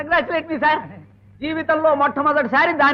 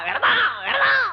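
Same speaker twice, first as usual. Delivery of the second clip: exasperated shout; 10 semitones higher than usual. Acted. Husband repeats himself to hard-of-hearing wife, shouting in exasperation. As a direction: call it out so she finally hears.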